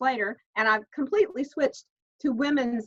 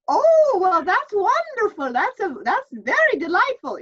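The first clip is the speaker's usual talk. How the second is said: much higher, louder Irish brogue through laughter; delighted priest in a hammed-up brogue, slow; the priest not missing a beat, delighted; Irish priest accent; 8 semitones higher, 7 dB louder than usual. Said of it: "He said".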